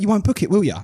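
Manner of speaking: a hint of a doubting partner; mock nagging partner. Quoting his partner teasing him about the tickets, mock nagging.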